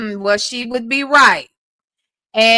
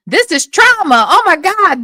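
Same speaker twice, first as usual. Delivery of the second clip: loud, high, laughing disbelief; thrilled over the drama; excited; 7 semitones higher, 6 dB louder than usual. Real, not acted. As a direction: cry out in delighted shock. Reacting excitedly to the clip.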